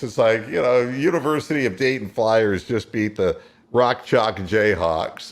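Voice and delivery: usual speaking voice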